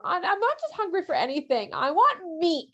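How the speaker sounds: high, crying whine; whining crowd, cracking into a cry on so; whiny complaining. Retold or imitated. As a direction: whimper like a hungry, spoiled child. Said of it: "the people start whining again".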